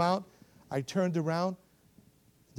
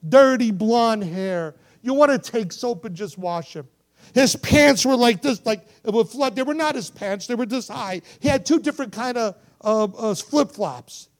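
loud, high, animated description; impassioned preaching; 8 semitones higher, 11 dB louder than usual. Acted. Preacher describing the little boy's clothes, impassioned preaching. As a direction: preach the picture vividly, at full volume.

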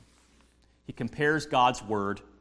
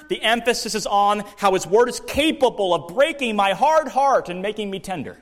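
louder, higher, driving each point home; forceful preaching; 7 semitones higher, 6 dB louder than usual. Acted. Pastor to his congregation, preaching at full force.

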